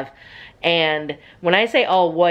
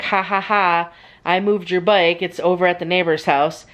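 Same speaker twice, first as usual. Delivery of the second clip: ordinary delivery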